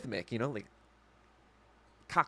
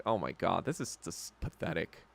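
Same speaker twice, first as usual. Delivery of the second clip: whispered disgust; 7 semitones lower, 10 dB quieter than usual. Retold or imitated. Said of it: "I'm like".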